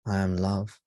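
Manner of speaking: usual speaking voice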